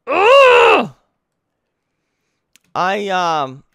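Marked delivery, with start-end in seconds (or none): 0.1-0.9 high, loud scream of exasperation; frustrated scream. Real, not acted. Player fed up with the game, screaming. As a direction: let out a long, high shriek of frustration.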